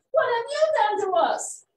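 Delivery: high, loud angry demand; demanding animals. A narrator's reading. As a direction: throw the accusation back at them. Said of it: "demand the other animals".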